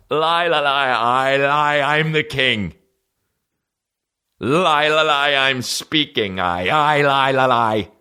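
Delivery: slow high stammering king; stuttering king. Retold or imitated. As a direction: struggle through each word. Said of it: "trying to speak".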